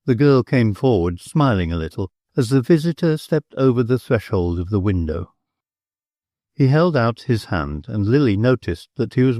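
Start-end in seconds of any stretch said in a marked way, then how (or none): none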